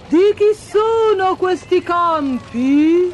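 high, loud, drawn-out royal voice; inquiring king. A narrator's reading. King asks the reapers from his carriage, slow and high. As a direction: ask slowly as a curious king. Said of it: "il re, sporgendosi, chiese".

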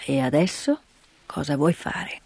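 put-on low, slow old man's voice; gruff grandfather. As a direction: speak like a reserved old mountain man.

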